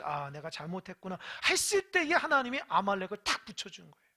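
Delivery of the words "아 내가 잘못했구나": low, softened admission of fault; sheepish regret. Acted. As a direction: own up quietly, chastened. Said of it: "머리를 극적이면서".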